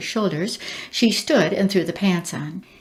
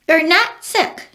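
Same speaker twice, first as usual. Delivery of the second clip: bird; 9 semitones higher, 4 dB louder, slower than usual. Acted. Bird answers the girl.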